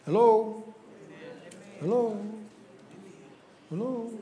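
echoing room sound; words ringing back in a bare room. Real, not acted. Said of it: "You hear the echo in here now?".

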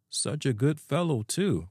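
musing, with a lifted, lilting start; reflective. A narrator's reading. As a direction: muse fondly on an absent friend. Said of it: "remarked the otter reflectively".

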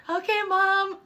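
high-pitched imitation of a kid; chastened son. Son giving in to his scolding mom, meek imitation. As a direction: answer meekly in a high child's voice.

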